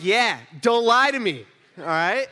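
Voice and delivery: loud, slow, firm warning; retold scolding. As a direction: lay down the law to a child.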